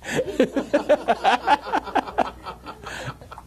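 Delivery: a high, breathy burst of laughter at his own joke. Real, not acted. Preacher laughing at his own joke about drink, high laugh.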